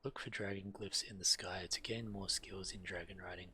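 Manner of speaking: ordinary talk, nothing marked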